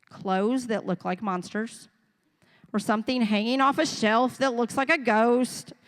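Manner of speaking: normal talking voice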